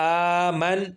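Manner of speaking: آمن with final ن made sakin; slow demo of the pause form. Shown as a demonstration. Teacher showing students the waqf form, slowly. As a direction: read آمن slowly and stop on the ن. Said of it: "اور یہ کیا بنے گا".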